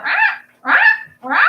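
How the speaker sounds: high, loud squawking rah; parrot squawk. Retold or imitated. Shrill mock of her parrot Pickle Rick squawking. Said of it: "Pickle Rick, if y'all hear that".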